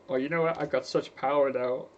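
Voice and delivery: a rough, lightly put-on voice of a smug rich man; lazy gloating tycoon, half-hearted impression; Bill Gates impression. Rich programmer boasting to the world, mild mock smugness. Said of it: "not a good impression".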